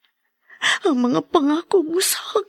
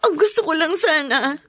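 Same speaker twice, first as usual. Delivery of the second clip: pleading through tears; tearful plea; 7 semitones higher than usual. Acted. Wife pleads with angry brother. (naiiyak na pakiusap)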